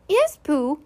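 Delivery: small animal's polite reply; Piglet. Acted. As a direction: answer in a high little voice. Voices Piglet in the story.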